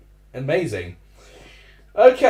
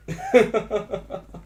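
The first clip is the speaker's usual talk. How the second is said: embarrassed laugh; 6 semitones higher than usual. Real, not acted. Laughing at own outburst.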